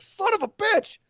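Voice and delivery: high mock dismay; hammed-up panicked curse. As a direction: squeak it out caught red-handed. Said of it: "they're both like".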